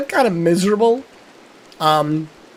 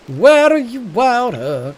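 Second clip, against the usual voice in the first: calling out; 5 semitones higher than usual. Real, not acted. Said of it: "calls out louder".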